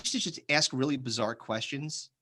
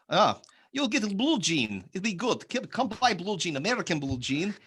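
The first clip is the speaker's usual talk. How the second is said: hammed-up Russian peddler; Russian accent; 5 semitones higher than usual. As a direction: hawk it in a put-on Russian accent. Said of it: "if I do a Russian accent".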